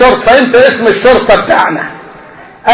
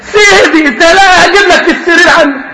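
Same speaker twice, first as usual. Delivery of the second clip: high, laughing, pleading servant voice; fawning servant; 6 semitones higher than usual. Acted. Servant fussing over his master, high and laughing. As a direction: grovel to your master through laughter.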